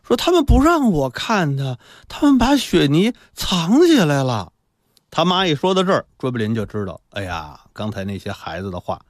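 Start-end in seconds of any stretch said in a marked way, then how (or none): 0.2-4.5 slow, high-pitched lament; plaintive mother. Acted. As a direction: complain in a drawn-out raised voice. Weak mother laments hidden son.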